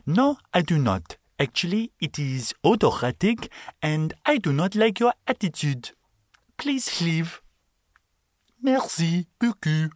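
high, haughty bad French accent; snooty French shopkeeper, hammed up. Shopkeeper dismissing a rude customer, bad French accent. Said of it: "the worst French accent ever".

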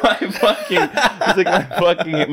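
laughing imitation. Imitating drunk friend.

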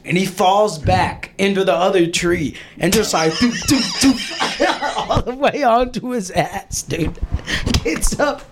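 giggles through the words; chuckling as the story lands; laughing while telling. Real, not acted. Describing Andy's fall, giggling the whole way.

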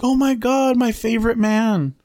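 very high, mock-delighted voice; imitating gushing woman. Acting Susan greeting him delightedly.

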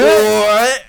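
high loud disbelieving what; stunned high what; shocked what. Real, not acted. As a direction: yelp it in disbelief. Louder shocked echo.

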